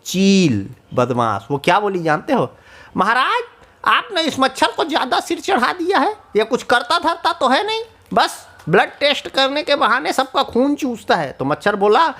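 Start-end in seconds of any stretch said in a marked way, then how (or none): 3.0-11.3 higher, tattling voice to the king; lightly voiced scheming bird; sly kite. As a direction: whisper poison in the king's ear.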